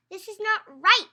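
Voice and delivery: loud, high firm protest; firm declaration. Acted. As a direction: declare it like a girl with a plan. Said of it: "young Sofia declared".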